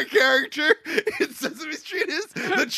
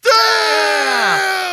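loud, high shocked cry; a real outburst of shock; shocked shout; 9 semitones higher, 8 dB louder than usual. Real, not acted. Scene: stunned by a friend's plot recap, shocked shout.

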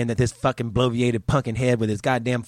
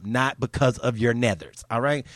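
ordinary talk, nothing marked

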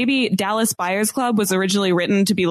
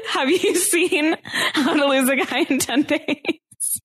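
slow question that breaks into laughter; giggling through a slow question; laughing; 7 semitones higher, slower than usual. Real, not acted. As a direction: ask it while cracking up. Asks while laughing at own point.

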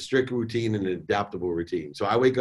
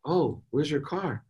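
slow, raised pitch, soft question; gentle, calm; 4 semitones higher, 4 dB quieter, slower than usual. Retold or imitated. Dad calmly asking daughter about car, gentle. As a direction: ask it slowly and lightly, without blame. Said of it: "in the right mindset".

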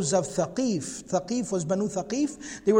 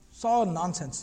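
ordinary talk

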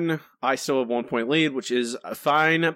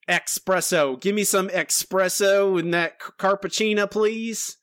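higher-pitched put-on Southern drawl; mock Southern accent; 6 semitones higher, slower than usual. Playing an ignorant coffee customer in a mock Southern accent. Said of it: "I don't know why he's Southern accent".

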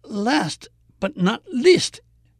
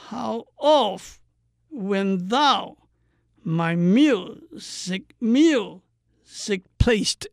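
"How oft when thou, my music, music placed" slowly, heavy on every second syllable; exaggerated light-heavy rhythm; much slower than usual. Shown as a demonstration. Teacher practising the line, exaggerated light-heavy rhythm on "How oft when thou, my music, music placed". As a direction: lean hard on OFT, THOU, MU- and PLACED, drawn out. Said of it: "特别强调轻重、轻重的节奏".

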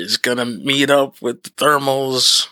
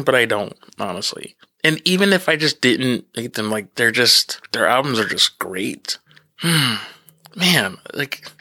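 ordinary talk, nothing marked

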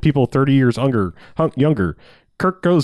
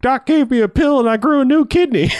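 high, loud mock scream of delight; 13 semitones higher, 4 dB louder than usual. Patient wheeled down the hallway, high mock scream. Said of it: "she's just screaming at the top of her lungs".

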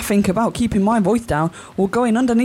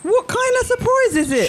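higher, insistent repeat of the question; louder repeat; 12 semitones higher than usual. Acted. Wife repeating her question to her husband, raised. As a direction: demand an answer, voice rising. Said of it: "keep your voice down".

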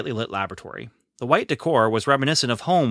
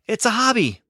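high, bashful little confession; sheepish; 12 semitones higher, 4 dB louder than usual. A narrator's reading. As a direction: shrug it off sheepishly. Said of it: "Another sheepish smile".

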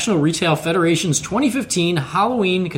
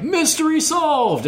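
mock triumphant; 12 semitones higher, slower than usual. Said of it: "people were like".

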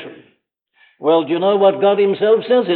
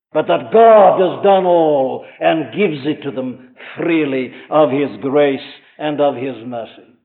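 ordinary talk

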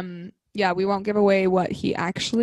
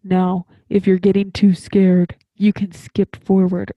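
slow, louder attempt at a deep scary narrator; spooky narrator voice; 7 dB louder, slower than usual. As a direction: try for the creepy audiobook warning. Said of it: "I can't make the deep, scary voice".

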